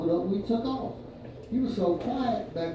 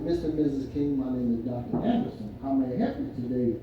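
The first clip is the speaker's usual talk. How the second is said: lower, softer polite doctor; calm doctor; 7 semitones lower, 5 dB quieter than usual. Retold or imitated. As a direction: greet the patients, measured. Retold doctor greeting.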